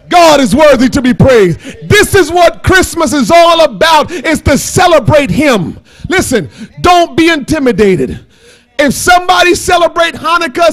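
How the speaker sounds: forceful preaching. Real, not acted. Preacher urges the congregation to celebrate Jesus, forceful preaching. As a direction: exhort the congregation with firm proclamations.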